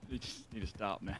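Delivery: ordinary talk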